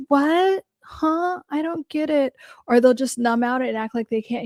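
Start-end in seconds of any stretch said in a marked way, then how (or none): 0.1-2.3 high-pitched mock confusion; feigned cluelessness. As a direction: play dumb, much higher voice, helpless questioning. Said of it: "That's learned helplessness".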